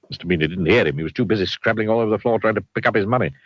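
ordinary delivery

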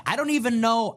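ordinary talk, nothing marked

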